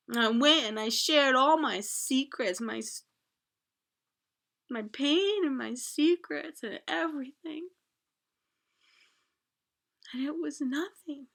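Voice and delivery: tearful lament. Retold or imitated. Continued tearful outburst.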